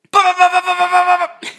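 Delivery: very high, loud mock gunshot pops; imitated gunfire. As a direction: rattle off the shots like a machine gun.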